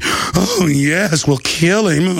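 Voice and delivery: relishing, hammed-up villainy; mock gloating. Host imitating the TV panel threatening him, mock gloating.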